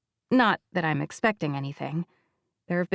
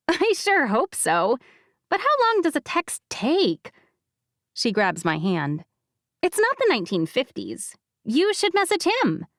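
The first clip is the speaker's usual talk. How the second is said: higher, pushy best friend; B's voice; 6 semitones higher than usual. Acted. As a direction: nudge her to text him.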